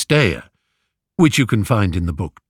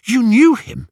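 eager, much higher and louder; young man eagerly seizing on hope; 13 semitones higher, 5 dB louder than usual. A narrator's reading. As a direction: leap at the news of his father. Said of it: "said Telemachus eagerly".

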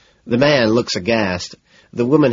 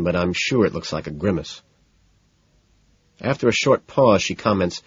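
plain reading voice

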